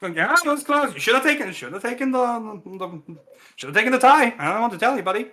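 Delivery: the champ, teasing. Acted. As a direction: tease the player like an old pal. GM voices champ to player.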